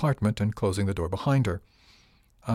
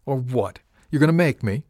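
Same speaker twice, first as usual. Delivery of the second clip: defiant challenge; 4 semitones higher, 5 dB louder than usual. A narrator's reading. Defiant sneering challenge to the captain.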